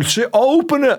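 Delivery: high, pleading cry from the sisters; panicked plea. Retold or imitated. Little sisters begging brother to open door, high panic. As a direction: beg him to open the door. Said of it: "they're going".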